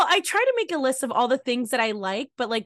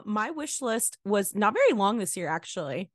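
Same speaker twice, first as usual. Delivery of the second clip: usual speaking voice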